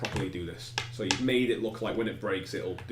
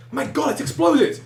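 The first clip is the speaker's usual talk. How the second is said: panicked cry; 9 dB louder than usual. Acted. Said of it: "someone goes".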